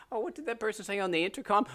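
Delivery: high, hammed-up Canadian accent on "intercom" with a laugh; mock Canadian announcement. Kid on the school intercom in a mock Canadian accent. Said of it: "I got hell for that".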